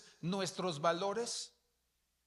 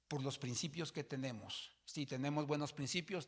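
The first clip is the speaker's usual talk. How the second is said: ordinary talk, nothing marked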